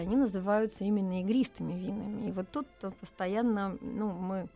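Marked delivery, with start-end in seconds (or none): none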